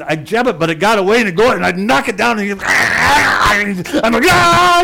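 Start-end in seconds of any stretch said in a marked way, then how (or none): 4.3-4.8 high loud yell at the coon; battle scream. Retold or imitated. Fighting the coon in the crib, a wild scream. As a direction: scream while jabbing with the post. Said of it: "I'm screaming".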